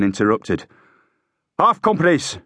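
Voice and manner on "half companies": high-pitched officer's shout; high, brief military order. A narrator's reading. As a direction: shout the command across to the sergeant. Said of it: "he shouted at Hakeswill".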